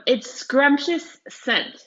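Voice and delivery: slow, savouring "its scrumptious scent"; slow reread. Shown as a demonstration. Teacher savouring a phrase for her class, slow. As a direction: draw out "its scrumptious scent" slowly, relishing it. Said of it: "So the sentence that says".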